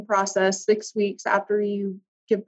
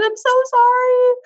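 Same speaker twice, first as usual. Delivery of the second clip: desperate apology; 5 dB louder than usual. Retold or imitated. Retold pleading apology to ex.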